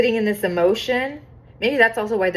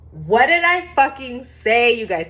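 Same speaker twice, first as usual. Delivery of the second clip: louder, higher triumphant outburst; emphatic, thrilled I-told-you-so; excited vindication; 5 semitones higher, 5 dB louder than usual. Real, not acted. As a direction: exclaim it as if just proven right. Vindicated by the next card.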